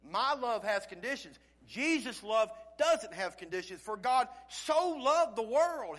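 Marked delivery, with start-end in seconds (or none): 3.8-6.0 "so" punched and drawn out; slow, emphatic verse with weight on "so"; stress on SO. Shown as a demonstration. Preacher contrasting Jesus' love with his own, "so" strongly stressed. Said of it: "there's special emphasis on the word "so".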